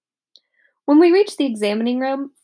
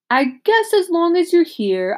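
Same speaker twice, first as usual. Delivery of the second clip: dentist voice; 8 semitones higher, 4 dB louder than usual. A narrator's reading. Dentist playing along.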